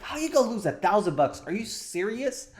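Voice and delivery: fast, high-pitched exasperated husband; mock angry. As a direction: act out getting angry, pitch climbing. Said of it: "okay, now I'm angry".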